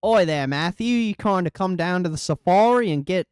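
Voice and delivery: a rusty Aussie voice calling Matthew to the safari; Australian accent. Acted. Inviting Matthew down to the safari in a rusty Australian accent. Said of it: "I had the voice on pat".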